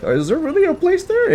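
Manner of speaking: retold in a very high, falsetto-like voice; high-pitched doubt. Doubting a restaurant on a sketchy road, high-pitched mimicry. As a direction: squeak out the doubtful question.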